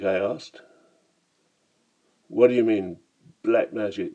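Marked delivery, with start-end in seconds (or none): none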